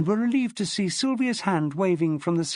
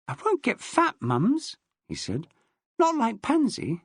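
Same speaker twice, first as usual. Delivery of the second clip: put-on little boy; 8 semitones higher than usual. Boy voice chatting.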